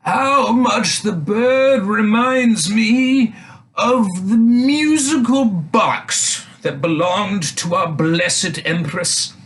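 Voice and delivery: put-on high, slow quavering old knight; drawn-out old courtier, reminiscing. A narrator's reading. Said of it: "An old knight said".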